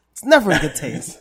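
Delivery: "never" punched loud; stress on never. Real, not acted. Host answering his own question with a loud "never". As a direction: hit "never" hard and loud.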